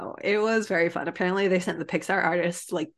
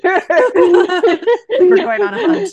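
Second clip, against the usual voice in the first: very high, loud, slow laughing declaration; hammed-up laughing battle cry; laughing hunt call; 12 semitones higher, 12 dB louder, much slower than usual. Laughing mock cat mission.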